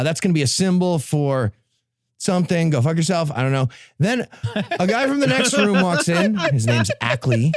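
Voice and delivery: ordinary talk, nothing marked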